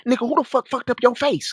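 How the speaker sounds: high-pitched, put-on yell of outrage; imitated angry yelling. Neighbor to his beat-up brother, imitated angry yelling. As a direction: mimic a heated man demanding answers. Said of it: "he yelling at his brother".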